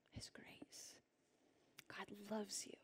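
very soft, tender near-whisper; hushed reassurance. Real, not acted. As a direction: let it drop to a gentle hush. Hushed tender reassurance after reading.

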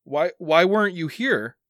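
high, stammered plea; hurt accusation. Acted. Son asks why dad wasn't there, pleading hurt. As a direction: demand an answer, pitched well up.

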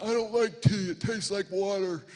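put-on whiny little kid, high and loud; mock protest, building to an indignant cry; disappointed child. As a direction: imitate a small boy's loud complaint. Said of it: "Ezra's immediately disappointed".

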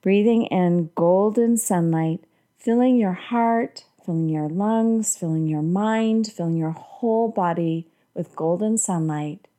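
normal talking voice

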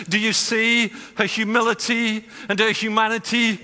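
loud, high, driving sermon voice; preaching at full force. Acted. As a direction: preach it out to the whole room with fire.

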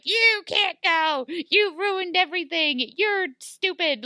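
high, slow, exaggerated telling-off; mock scolding. Blue fairy whose plan was spoiled, mock scolding. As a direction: imitate a fairy's outraged reproach.

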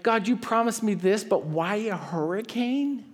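mild lament; complaining to God. Retold or imitated. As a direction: whine at God in frustration. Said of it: "you're like".

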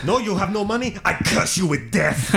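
hammed-up fortune-teller curse; laying a curse. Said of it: "were living up to their reputation".

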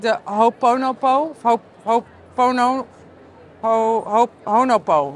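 stumbling over Ho'oponopo in broken attempts; unsure pronunciation. Real, not acted. Speaker attempts an unfamiliar exercise name, unsure pronunciation. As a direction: sound out Ho'oponopo haltingly, restarting on "Ho-". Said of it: "ik weet niet of ik het goed uitspreek".

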